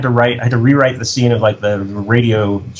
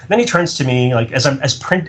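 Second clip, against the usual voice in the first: ordinary talk, nothing marked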